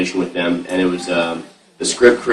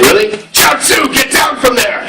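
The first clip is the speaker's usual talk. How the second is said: Tension Han impression; 13 semitones higher than usual. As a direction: call out like Tien to Chiaotzu. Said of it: "I'm like".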